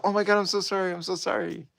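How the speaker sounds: high, breathy sorry thrown into the air; lightly voiced airy sorry; mock apology. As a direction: toss a high apology at nobody. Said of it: "Judy's like".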